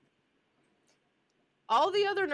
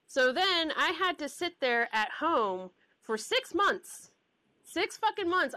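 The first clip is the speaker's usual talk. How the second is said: louder, higher, drawn out; exasperated; 7 semitones higher, 3 dB louder than usual. Real, not acted. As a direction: vent the frustration. Exasperated about waiting months.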